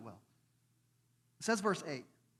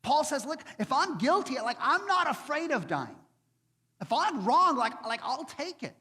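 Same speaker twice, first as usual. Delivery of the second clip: bold defiance; 7 semitones higher, 5 dB louder than usual. Retold or imitated. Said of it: "He says".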